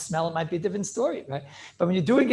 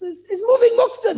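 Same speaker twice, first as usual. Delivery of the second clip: much higher and louder, laughing through it; excited laughing quote; laughing emphasis; 14 semitones higher, 7 dB louder than usual. Retold or imitated. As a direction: burst out laughing as you land the point. Quoting the Ritva, laughing.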